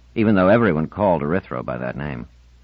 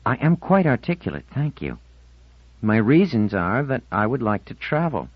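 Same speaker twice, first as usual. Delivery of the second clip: put-on higher teenage girl, stubborn and touchy; a touchy fifteen-year-old digging in; young girl's voice, defensive; defiant teen girl; 6 semitones higher than usual. Marlena voiced primly.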